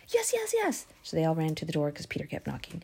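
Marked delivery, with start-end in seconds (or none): 0.1-0.9 high whispered insistence; a girl begging them to believe; insistent girl. Acted. Rhoda insisting to doubters, high and hushed.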